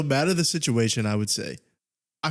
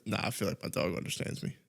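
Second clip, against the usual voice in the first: whispering; 4 semitones lower, 10 dB quieter than usual. Real, not acted. Unvoiced quiet remark.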